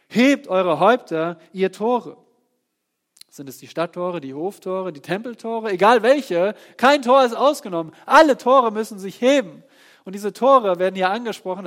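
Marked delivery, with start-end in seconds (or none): 0.1-2.2 proclaimed command. Acted. 5.7-11.6 loud, rising sermon build; fiery pulpit climax; full-force preaching. Acted.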